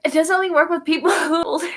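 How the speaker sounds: put-on amused retelling of a kid's question; laughing question. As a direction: laugh through the retold question. Laughing through retold question.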